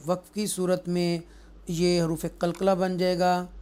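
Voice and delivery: ordinary talk, nothing marked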